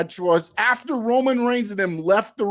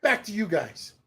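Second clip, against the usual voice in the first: lightly mocked stiff announcer, softer; imitating TK; 3 semitones lower, 4 dB quieter than usual. Co-host mocking TK announcing Rampage, lightly stiff. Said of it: "He's like, he's like".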